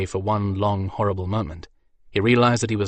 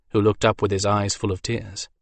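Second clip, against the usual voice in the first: ordinary delivery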